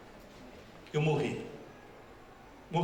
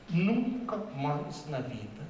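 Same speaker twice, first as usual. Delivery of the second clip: slow emphasis; much slower than usual. Real, not acted. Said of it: "slowed emphasis on never again".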